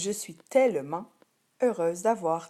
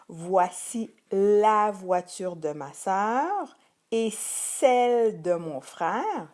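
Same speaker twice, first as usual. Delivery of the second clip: sentence read slowly and a little louder; slow example sentence; 5 dB louder, much slower than usual. Shown as a demonstration. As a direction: read it out slowly so every word is heard. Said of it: "dans la phrase".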